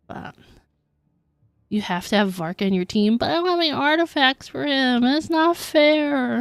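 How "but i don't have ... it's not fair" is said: whiny complaint. Real, not acted. Whiny complaint about artifacts.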